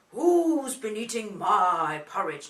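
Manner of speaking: big, loud, slow Daddy bear; deep Daddy voice. Shown as a demonstration. Daddy bear finds his porridge eaten, deep booming voice. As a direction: boom it out slowly as a deep-voiced father bear. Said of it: "Nice big voice for Daddy".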